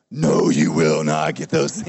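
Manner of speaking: hammed-up low demand from the pregnant wife; mock demanding wife. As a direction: growl it out like a wife who won't wait. Pregnant wife demanding food now.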